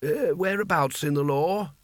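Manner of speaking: slow, hesitant query with an opening "uh"; uncertain call. A narrator's reading. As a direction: call out timidly, unsure of the rule. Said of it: "a voice called out uncertainly".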